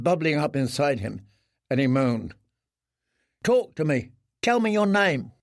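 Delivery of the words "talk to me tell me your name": lightly voiced pressing boy.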